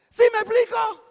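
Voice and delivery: pregunta entre risas, más aguda; riendo. Real, not acted. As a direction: suelta la risa al preguntar. Risa en la pregunta. (al público, riendo: ¿sí me explico?)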